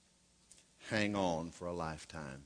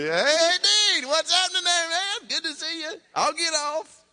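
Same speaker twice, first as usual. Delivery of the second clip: high, loud, chummy bro voice; buddy greeting the dean; 14 dB louder than usual. Retold or imitated. Preacher acting out greeting the dean, chummy and loud. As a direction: clap the dean on the back, pitched way up.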